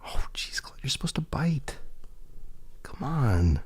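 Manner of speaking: hushed unvoiced whisper to the cat. Real, not acted. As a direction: breathe it out softly, teasing the cat.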